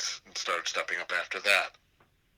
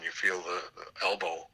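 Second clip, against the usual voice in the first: usual speaking voice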